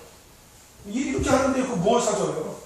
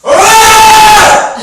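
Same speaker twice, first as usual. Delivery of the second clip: lion roar; 14 semitones higher, 17 dB louder than usual. Pretend. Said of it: "사자가 어떻게 울어?".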